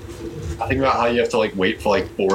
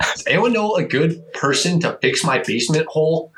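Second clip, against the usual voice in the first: higher-pitched, lightly put-on old-timer; mock neighbor request; 7 semitones higher than usual. Comic mimicking a neighbor seeking handyman, mock earnest. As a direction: imitate an earnest boomer post.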